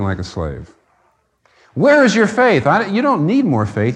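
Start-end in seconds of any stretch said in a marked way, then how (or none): 1.7-4.0 preaching shout. Real, not acted. Preacher exhorting the congregation.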